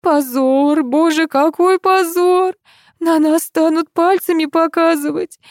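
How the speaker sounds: high, slow tearful lament; crying. Acted. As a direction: sob out the shame, slowly. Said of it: "внезапно заплакала".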